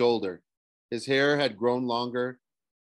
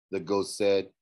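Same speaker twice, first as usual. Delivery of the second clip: usual speaking voice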